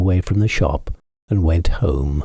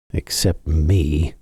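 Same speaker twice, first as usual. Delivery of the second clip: mournful cat; 3 semitones lower, slower than usual. A narrator's reading. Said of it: "said Simpkin".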